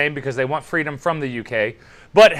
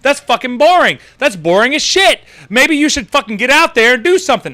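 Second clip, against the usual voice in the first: loud, higher-pitched scoffing rant; indignant retort; 4 semitones higher, 5 dB louder than usual. Retold or imitated. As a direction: fire back, loud and exasperated. Retorts to quoted British lady.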